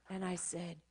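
usual speaking voice

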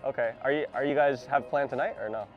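usual speaking voice

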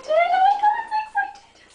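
excited squeal. Real, not acted. Squealing about birthday box.

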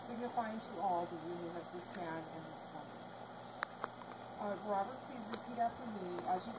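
measured formal ceremony voice; wedding officiant. Acted. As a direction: speak like an officiant at the altar. Officiant explains the rings.

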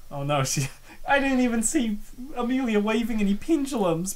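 ordinary delivery

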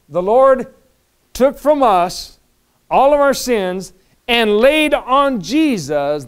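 slow, loud, high proclamation; measured, forceful pulpit delivery; preaching. Real, not acted. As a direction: declare it phrase by phrase, loudly.

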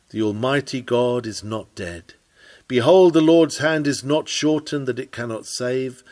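usual speaking voice

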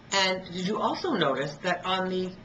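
ordinary talk

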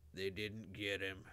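slow, heavy bass voice; heavy bass mutter. A narrator's reading. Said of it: "he muttered in his heavy bass voice".

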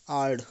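आर्ड with a hard D at the end; German-style आर्ड. Shown as a demonstration. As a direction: say आर्ड, ending on D instead of T. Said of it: "आर्ट ना बोल के आर्ड पढ़ते हैं".

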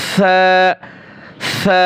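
সা said softly, tongue tip at the upper front teeth; সা as a soft th, not an s; soft Arabic tha. Shown as a demonstration. Teacher drills the soft সা, tongue at the teeth. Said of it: "জিহ্বার আগা উপরে দুই দাঁতের সামনে দিয়ে".

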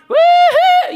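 loud, high whoop of triumph; excited whoop. Acted. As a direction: cheer like you just won. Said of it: "Peter's sitting there going".

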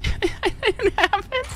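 high, slow, laughing disbelief; squeaky laughing take; laughing denial. Real, not acted. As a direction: giggle it out in denial.

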